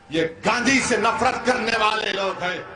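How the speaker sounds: normal talking voice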